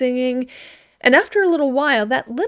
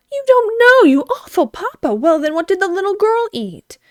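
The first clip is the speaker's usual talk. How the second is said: much higher, louder outraged cry; indignant scolding; 9 semitones higher, 5 dB louder than usual. A narrator's reading. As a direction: scold the awful papa in mock outrage. Girl scolds her papa.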